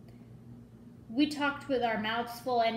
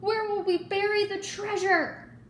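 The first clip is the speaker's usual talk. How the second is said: distressed shout; 10 semitones higher, 4 dB louder than usual. A narrator's reading. Pirate captain wails in storm.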